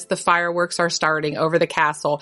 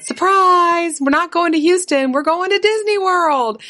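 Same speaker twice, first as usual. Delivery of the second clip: high, loud, joyful announcement; spring the big surprise on the kids; excited surprise reveal; 10 semitones higher, 3 dB louder than usual. Retold or imitated. Parents surprising kids with Disney.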